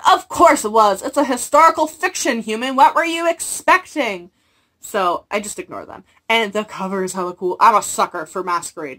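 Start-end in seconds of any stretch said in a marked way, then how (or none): 0.1-4.3 high, loud, slowing mock exasperation; exasperated retort. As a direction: roll your eyes at the complainer. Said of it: "And I'm like".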